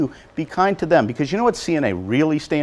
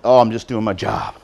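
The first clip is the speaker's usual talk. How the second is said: mock modest worker; 7 semitones lower than usual. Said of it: "this thing about".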